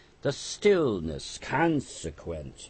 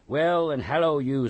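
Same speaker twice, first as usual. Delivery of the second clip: hoarse, louder, higher call; 7 semitones higher, 7 dB louder than usual. A narrator's reading. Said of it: "more hoarsely than before".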